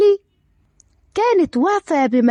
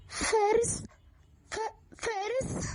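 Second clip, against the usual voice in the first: hushed, high, stammering gasp of a name; flustered shock; 6 semitones higher, 15 dB quieter, slower than usual. Acted. Aya caught by Fares at college, shocked and flustered. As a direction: falter on the name, barely voiced. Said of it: "آية بارتباك وصدمة".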